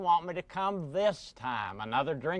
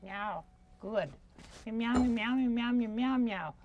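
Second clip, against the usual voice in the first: meowing cat-puppet voice; pussycat puppet; 6 semitones higher, 4 dB quieter than usual. Acted. Cat calling a friend to come, meowing cat voice. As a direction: talk as a little cat, meows between the words.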